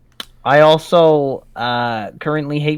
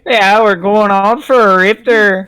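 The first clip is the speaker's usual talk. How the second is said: exaggerated Canadian accent, higher; 8 semitones higher, 5 dB louder than usual. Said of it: "your Canadian accent".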